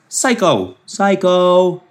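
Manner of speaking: high, drawn-out taunt; high-pitched mocking verdict; mock singsong. Mocking owners as psychos.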